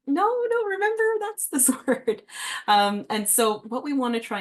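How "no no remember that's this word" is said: put-on high, pushy "no, no" correcting a child; hammed-up high corrective voice; mock corrective parent. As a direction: mimic a parent jumping on a reading mistake. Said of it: "we're going".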